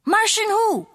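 high, curious voice; high puzzled question. Acted. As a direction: ask it high. Knock-knock joke question.

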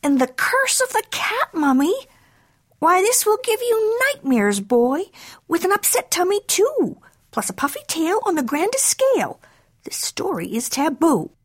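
high-pitched, theatrical motherly warning; fussing mama cat. Acted. Mama cat warning her boy off a magazine, fussing.

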